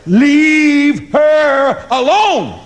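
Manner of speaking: slow, high, solemn voice of God; a booming mock-divine warning. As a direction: hand down a divine command, drawn out. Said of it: "saith God".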